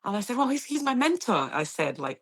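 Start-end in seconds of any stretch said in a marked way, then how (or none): 0.4-1.5 higher, eager announcement; excited student boasting; all excited. Retold or imitated. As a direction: proudly tell them he's her mentor. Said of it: "all excited".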